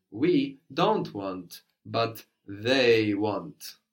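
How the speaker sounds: very slow, with "we" and "they" set against each other; contrastive stress. Shown as a demonstration. Teacher modelling contrastive stress for a student: slow and deliberate. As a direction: spell out the contrast between "we" and "they", word by word. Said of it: "we will emphasize the word for we".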